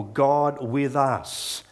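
drawn out very slowly, every word weighed; preacher unpacking the name Emmanuel, very slowly; slow emphasis. Shown as a demonstration. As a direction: let God with us hang in the air.

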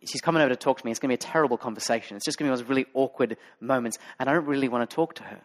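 fast, anxious thinking aloud; rushed inner thought. Retold or imitated. Preacher voicing his own reluctant thoughts, fast and fretful. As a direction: rattle off the dread quickly. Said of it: "I thought".

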